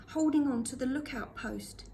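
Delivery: ordinary delivery, nothing marked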